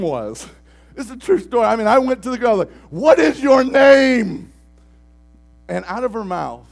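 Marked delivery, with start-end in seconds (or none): 2.9-4.5 loud, drawn-out shout; slow shouted demand. Retold or imitated. Youth pastor demands the demon's name, slow shout.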